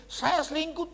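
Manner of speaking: high, loud mock-wife voice; protesting wife. Wife defending herself to the pastor, high loud mock protest. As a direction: imitate a wife loudly pleading her case, pitched right up. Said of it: "Dia bilang begini".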